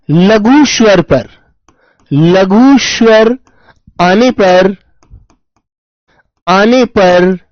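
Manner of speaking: "लघु" drawn out, dictated word by word; slow dictation. Shown as a demonstration. Teacher dictating a grammar rule for students' notebooks, slow. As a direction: spell out the rule slowly so they can write. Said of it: "dictating rule slowly".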